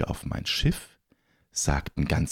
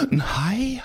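gasp, then high startled question; alarmed question. Acted. Captain asking his lookout about a shark, alarmed. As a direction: gasp and ask in mock alarm.

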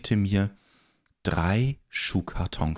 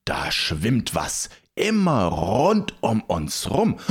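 lookout calling out; 2 semitones higher, slower than usual. Acted. Lookout reporting something in the water to the captain, calling out slowly. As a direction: call out a sighting, stretching the words. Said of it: "rief der Ausguck".

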